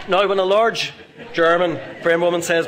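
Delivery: laughing. Real, not acted. Audience laughter, retelling punchline.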